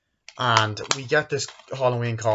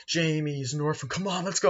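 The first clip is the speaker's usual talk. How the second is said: mock taunting kids; 6 semitones higher than usual. Retelling kids chanting taunt.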